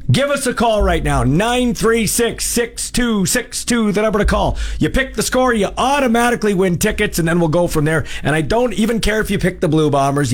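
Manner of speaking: upbeat high announcer pitch; radio promo read. Acted. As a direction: sell the contest with energy. Hyped contest promo read.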